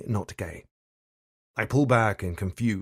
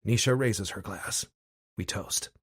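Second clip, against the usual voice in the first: ordinary delivery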